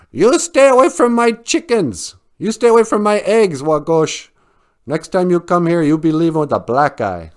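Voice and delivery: high, loud scolding threat; angry chicken. Acted. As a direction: yell it at the egg thief. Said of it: "Baka Akwe gets just mad".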